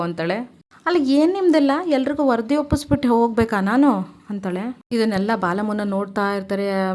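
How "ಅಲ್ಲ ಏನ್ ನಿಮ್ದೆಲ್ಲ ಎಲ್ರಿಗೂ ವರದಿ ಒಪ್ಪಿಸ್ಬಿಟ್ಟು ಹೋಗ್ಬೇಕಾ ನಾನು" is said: high, loud mock outrage as the heroine; indignant retort. Heroine snapping at her husband's brother, mock indignant and loud. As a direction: fire it back at him, half-acted.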